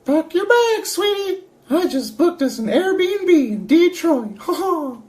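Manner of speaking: very high falsetto cartoon mouse; squeaky, chirpy cartoon character voice; Mickey Mouse. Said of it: "Mickey Mouse".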